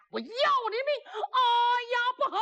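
very high acted outcry; heated high-pitched exclamations; battle alarm. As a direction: throw the pitch right up in alarm. Pursuer threatens, fighter panics.

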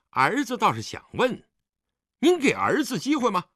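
high-pitched, insolent retorts; mocking, provocative indignation; defiant sarcasm. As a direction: throw the blame back at your father. Son sarcastically argues back.

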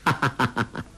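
staccato chuckle in short bursts; acted amused "ha-ha-ha"; easy gloating laugh; villain's laugh. A narrator's reading. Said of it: "笑道".

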